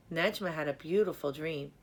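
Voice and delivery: ordinary delivery, nothing marked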